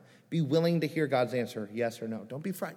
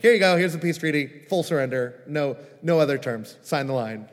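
louder, fast, pushy terms-of-surrender pitch; intense ambassador; 7 dB louder than usual. Pretend. Ambassador pressing a peace treaty on someone, fast and loud. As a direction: rattle off the terms like a hard-nosed envoy. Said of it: "that would be an intense ambassador".

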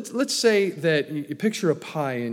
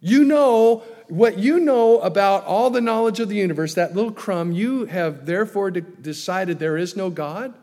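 louder, higher sarcastic retort; mocking challenge; 4 semitones higher, 6 dB louder than usual. Preacher challenging Mister Crumb Knowledge, loud and mocking. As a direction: scoff at the know-it-all.

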